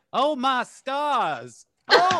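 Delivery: a clutch-the-pearls Southern belle "Oh my stars". Acted. Mocking a senator as a Southern belle, scandalized Southern gasp. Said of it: "one of those vaguely polite Southern".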